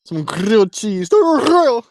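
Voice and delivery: slow mock-royal British accent, climbing high; drawn-out, rolled British royal put-on; old royalty. Shown as a demonstration. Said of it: "say it in old royalty".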